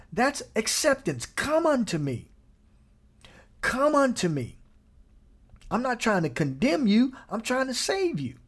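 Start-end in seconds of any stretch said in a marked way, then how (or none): 1.3-4.5 gentle invitation. Retold or imitated. Jesus calling the weary, gently invites. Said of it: "voicing Jesus, invites gently". 5.7-8.4 higher, gentle, reassuring; tender reassurance. Retold or imitated. God reassuring the sinner, higher and tender. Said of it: "God speaks to listener".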